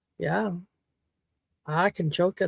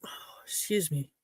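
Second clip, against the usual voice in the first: breathy whispered excuse-me, off to the side; a quick real whisper of apology; whispered aside; 11 dB quieter than usual. Real, not acted. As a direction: whisper it to yourself after a cough. Aside after choking.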